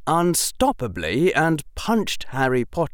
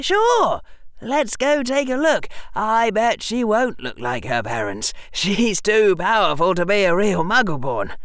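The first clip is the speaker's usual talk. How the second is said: mock confident Draco; 12 semitones higher, 4 dB louder than usual. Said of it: "you'd say".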